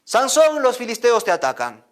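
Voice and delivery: urgent cry that the enemy is coming; shouted warning. Acted. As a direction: yell it out to wake him.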